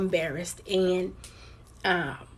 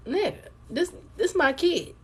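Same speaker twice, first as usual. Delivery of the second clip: pitched up high, firm and heated against him; heated retort; 9 semitones higher than usual. Retold or imitated. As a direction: snap back at him, defending her kid. Arguing with daughter's father.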